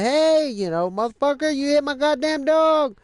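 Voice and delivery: very high, loud enraged shouting; furious yelling. Retold or imitated. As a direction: scream after the driver who hit your dog. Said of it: "started yelling".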